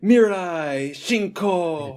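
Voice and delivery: high, drawn-out chant; stretched, high-pitched mock cheer; playful mock scream. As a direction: call it out slowly like a street-corner fanatic. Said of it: "and just scream".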